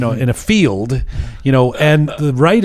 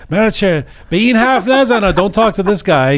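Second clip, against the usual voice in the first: retold yell; 6 semitones higher, much faster than usual. Mechanic warning his coworker not to talk to him, raised. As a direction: bark the order across the room, raised voice. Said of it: "screaming across the garage".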